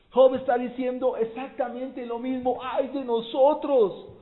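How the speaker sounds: loud, high preacher's cry, building to the lament; preaching at full force. Acted. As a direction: proclaim it to the whole church. Predica con toda fuerza. (predicación fervorosa, más alta y fuerte)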